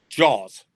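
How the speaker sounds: Jaws said like the movie title, punched loud; "Jaws" as one firm, low, loud word; demonstrated pronunciation. Shown as a demonstration. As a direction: say "Jaws" loudly as one syllable, like the shark film. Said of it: "the correct pronunciation is".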